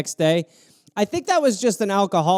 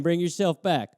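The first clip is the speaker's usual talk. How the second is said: ordinary talk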